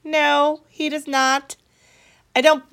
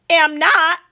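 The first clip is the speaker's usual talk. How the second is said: loud, higher retort; a girl shouting back at her accuser; 6 semitones higher, 6 dB louder than usual. Acted. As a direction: fling it right back at her. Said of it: "The other student shouts".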